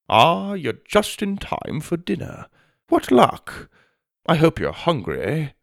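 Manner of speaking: high, delighted formal announcement; pompous headmaster. Acted. Headmaster checks pocket watch and announces dinner, pleased and pompous.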